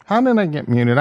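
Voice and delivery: loud, exasperated question at being muted; real irritated shout at the mic; frustrated outburst. Real, not acted. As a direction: blurt it out in real annoyance. Cut off mid-thought, frustrated.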